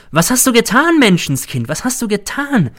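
high, imitated shriek of horror, half-acted; a hammed-up cry of horror retold in a plan; mock shrill scream. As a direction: shriek it as a faked accusation. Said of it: "hätte gellend geschrien".

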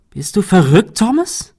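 high, loud disbelief; distraught and incredulous; desperate, latently angry. A narrator's reading. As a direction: challenge him in desperation, pitch soaring. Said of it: "Shirley klang verzweifelt und latent wütend".

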